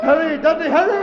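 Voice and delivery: loud, high urgent yell; desperate shout. Acted. Fowler fights off the Morlocks, shouting at Dudley. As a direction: shout for him to hurry.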